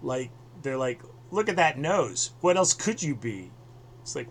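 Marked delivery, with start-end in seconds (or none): 1.3-3.5 higher, louder mock-teasing voice; mock pushy stranger. [name] imitates people mocking his nose, higher and louder. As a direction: play the rude stranger poking fun, hammed-up.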